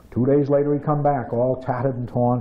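ordinary delivery

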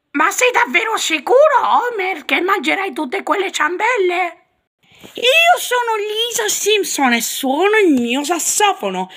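cartoon mom voice. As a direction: scold Homer over the donuts. Marge and Lisa voiced.

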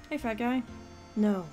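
ordinary talk

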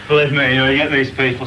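emphatic exclaim. Real, not acted. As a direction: blurt it out with feeling. Exhausted novice exclaims to interviewer.

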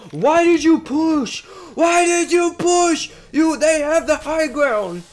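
high, loud, slow scolding in a put-on voice; mock angry teammate. Streamer imitating enemy teammates cursing out their Octane, hammed-up angry. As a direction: play the furious teammate yelling at the Octane. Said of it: "I bet your teammates are just cursing you out".

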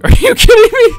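high, loud laughing disbelief; incredulous laughing yelp. Real, not acted. Disbelief at customer.

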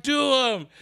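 high-pitched imitation of an eager audience; mock crowd call. Audience answers the comic, mock crowd call.

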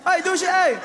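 loud high catcalling yells; catcall shout. Retold or imitated. Builder hollers at a passing woman, loud catcall. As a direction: holler at a woman across the street. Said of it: "soms heb je het gewoon nodig".

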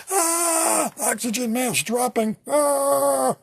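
high mock screaming of panicked passengers; mock passenger screams. Comic retelling of a plane crash, mock screaming.

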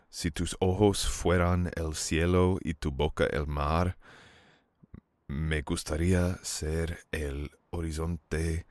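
slow romantic verse, each line lingered over; romantic Spanish recitation. Acted. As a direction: court her with a slow Spanish poem. Reciting Spanish love verse to date.

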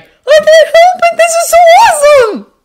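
put-on very high, loud excited kid; bursting with enthusiasm, voice sky-high; jittery excitement. A narrator's reading. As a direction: gush in a squeaky boy voice. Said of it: "his enthusiastic jittering".

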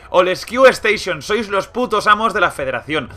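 high, loud gushing thanks; excited praise. Real, not acted. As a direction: rave about the station. Praising the station that saved him.

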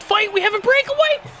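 very high, loud panicked plea; frantic yelling. Real, not acted. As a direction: scream in disbelief to stop the fight. Fight breaks out during his breakaway.

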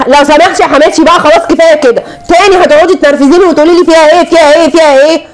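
finally snapping at her, voice climbing; exasperated outburst. Acted.

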